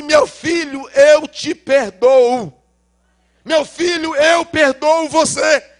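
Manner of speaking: loud, high, slow tearful plea; sobbing forgiveness. Retold or imitated. Father sobbing as he forgives his son. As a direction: weep the words out slowly. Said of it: "ele me dá um abraço soluçando".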